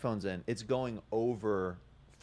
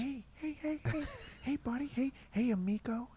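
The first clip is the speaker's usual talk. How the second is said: high, soft Owen Wilson soothing; Owen Wilson impression; 13 semitones higher, 6 dB quieter than usual. Owen Wilson calming a kid on a plane, high and soft. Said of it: "Owen Wilson calming kids down on planes".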